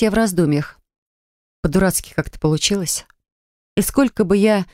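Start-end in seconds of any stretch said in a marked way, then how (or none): none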